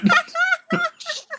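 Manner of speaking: squealing laugh; high burst of laughter. Real, not acted. Hard laugh at own story.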